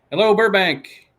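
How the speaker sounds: high, loud greeting to Burbank; loud hello. Shown as a demonstration. Greeting Burbank viewers on the stream, loud and high. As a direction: call it out to the whole city. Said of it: "Hello, clap Burbank".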